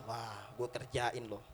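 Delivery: low, slow, soft threat; quietly vengeful. Acted. Angry Nuaiman vows to prank Suwaibit, muted threat. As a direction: plot payback under your breath. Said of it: "Marah Nuaiman".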